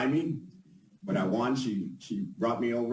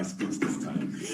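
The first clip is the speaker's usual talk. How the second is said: laughing; 3 semitones lower, slower than usual. Real, not acted. Laughs at own written speech.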